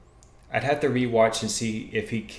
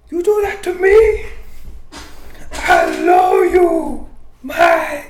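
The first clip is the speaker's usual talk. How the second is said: high-pitched, loud, drawn-out mock Romero accent; 12 dB louder, slower than usual. Host clowning as the fighter after the fight, badly faked Romero accent. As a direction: shout it slowly in a hammed-up high accent. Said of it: "I can't do the Romero, uh, accent really good".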